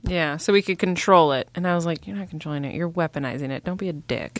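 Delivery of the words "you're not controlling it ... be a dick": muttered retort. Retold or imitated. Retelling own retort to villain.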